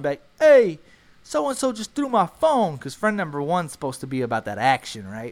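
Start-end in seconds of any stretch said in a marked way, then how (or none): 0.4-2.8 high, loud indignant cry; indignant complaint. Retold or imitated. Friend two complaining to friend one, high and loud. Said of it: "Friend number two tells friend number one".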